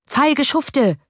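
high, contemptuous outburst; scornful call. A narrator's reading. Lieutenant cursing the citizens' company, scornful. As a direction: spit out the insult. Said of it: "rief der Leutnant".

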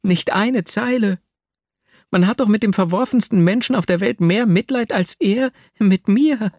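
put-on a wounded girl complaining of her lover; Nastjenka's voice. A narrator's reading.

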